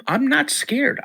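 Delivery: not scared with a high jump on not; stress on not. Retold or imitated. Kid telling dad he's not scared, "not" jumping high. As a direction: pitch "not" way up: I'm not scared. Child denying fear in story.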